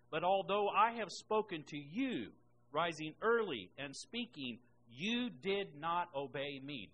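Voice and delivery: emphatic, slowing proclamation of scripture; scripture reading. Acted. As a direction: read out God's charge with weighty stresses. Reads God's words from scripture.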